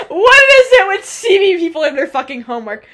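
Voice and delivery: loud, high, fed-up question; exasperated rant. Real, not acted. Complaining to her friend about Simi guys and homework, loud and fed up. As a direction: vent it loudly, half laughing.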